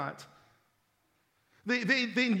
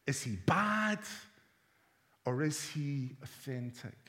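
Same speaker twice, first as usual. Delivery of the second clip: quieter, lower sermon voice drawing listeners in; hushed preaching; 6 semitones lower, 7 dB quieter than usual. Acted. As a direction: preach softly, almost confiding. Quiet reflective preaching passage.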